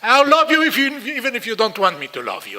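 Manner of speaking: high, loud declaration of love; loud loving insistence. Retold or imitated. Parent answering the angry child, loud loving insistence. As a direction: shout the love at him.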